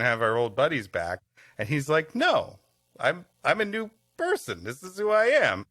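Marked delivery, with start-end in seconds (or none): none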